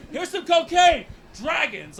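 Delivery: high, hushed mock offer; mock furtive offer. Dealer springing cocaine on a guest, hushed mock offer. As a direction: say it small, high and very soft.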